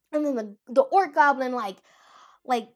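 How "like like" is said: ordinary talk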